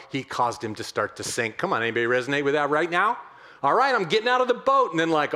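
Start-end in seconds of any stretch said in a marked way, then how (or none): 3.6-4.9 high, loud, quick burst; eager mock bravado, pitched up; acted bold eagerness. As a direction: imitate someone boldly jumping in. Acting Peter boldly leaving boat.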